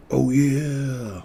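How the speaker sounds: drawn-out, booming Oh yeah; Kool-Aid mascot catchphrase. Pretend. Trader with Kool-Aid song in his head, playful booming catchphrase. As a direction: belt out a jingle catchphrase playfully.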